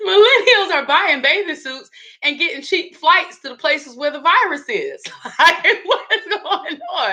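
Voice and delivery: high, laughing disbelief; cracking up while telling it. Real, not acted. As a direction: laugh through the words. Laughing at the meme.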